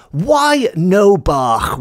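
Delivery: slow, lightly Welsh "Why no bach?" with a rolled throaty "bach"; drawn out "Why no bach?", just a hint of an old Welsh farmer. Farmer gently soothes his worried wife, slow and lightly Welsh. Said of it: "these are direct quotes".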